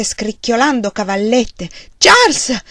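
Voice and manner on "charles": high, loud panicked scream. A narrator's reading. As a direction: call him in sheer terror. Wife screams for husband. (urlo di panico)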